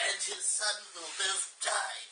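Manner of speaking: sinister whisper, voiceless; sly whisper. Acted. Narrator voicing Scar as sly and scary, whispered. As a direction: hiss the threat in a sly whisper. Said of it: "What voice is Scar gonna have?".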